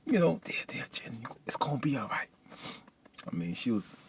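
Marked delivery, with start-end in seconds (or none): 0.4-2.3 soft, half-whispered comfort; soothing consolation. Acted. Viewer comforting grieving Jen, soft soothing. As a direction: hush it gently, as if consoling.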